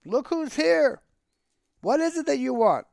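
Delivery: high, animated patter; exclaiming, theatrical storyteller's Tevye; forced cheerful. A narrator's reading. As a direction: rattle off woes with put-on cheer. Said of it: "trying to sound cheerful".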